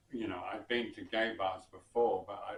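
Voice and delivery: ordinary delivery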